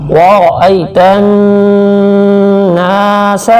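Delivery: slow chanted tajweed recitation; Quran recitation. Shown as a demonstration. Teacher demonstrating recitation to students, slow and chanted. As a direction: draw out each syllable in melodic recitation. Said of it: "Urdu تلفظ".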